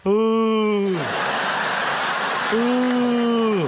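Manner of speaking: a big, drawn-out yawn sound; mock yawn, long and open. Shown as a demonstration. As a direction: stretch the mouth into a slow yawn. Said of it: "goes like this".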